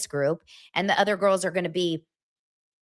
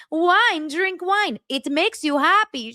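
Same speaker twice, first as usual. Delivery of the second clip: mock chef; 10 semitones higher, slower than usual. Host imitating the chef urging wine, higher coaxing. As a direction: push the wine like a cheery chef. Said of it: "the chef who's trying to help them".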